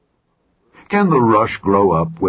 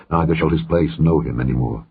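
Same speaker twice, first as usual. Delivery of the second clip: ordinary delivery, nothing marked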